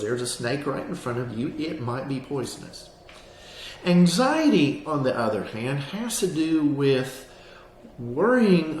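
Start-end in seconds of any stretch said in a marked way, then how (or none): 3.8-4.8 Anxiety punched loud and high; emphatic. Acted. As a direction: hit the word Anxiety hard. Contrasting anxiety with fear.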